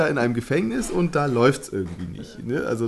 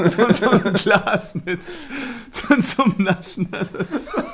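talking through laughter; 7 semitones higher, slower than usual. Real, not acted. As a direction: struggle to get it out while laughing. Cracking up at a gross joke. (vor Lachen stockend sprechen)

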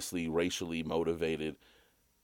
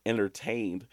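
normal talking voice

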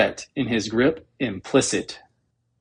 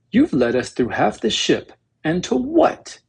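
high, fussy, indignant protocol droid; fussy robot; 7 semitones higher than usual. Acted. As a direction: fret indignantly like a prim robot. C-3PO complains to R2.

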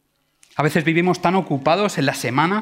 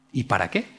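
1 calm shrug; 7 semitones lower, 6 dB quieter than usual. Acted. Unbothered fisherman answering tourist.

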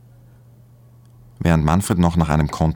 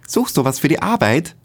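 high, bright-voiced curious woman; nosy partner, much higher; curious girlfriend; 12 semitones higher than usual. A narrator's reading. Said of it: "fragt ihn Magdalena neugierig". (neugierig, mit viel höherer Frauenstimme)

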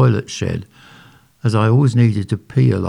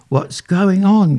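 croaked; 10 semitones higher, 3 dB louder, slower than usual. A narrator's reading. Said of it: "croaked Janice".

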